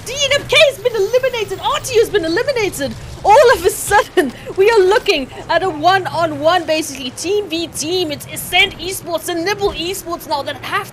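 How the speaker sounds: loud, high, screaming caster call; frantic play-by-play. Acted. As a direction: call the win at full hype. Live play-by-play climax.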